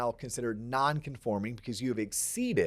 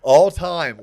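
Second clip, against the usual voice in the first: louder mock-grand repeat; loud echo; 2 semitones higher, 12 dB louder than usual. Real, not acted. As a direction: boom it back. Echoing the praise.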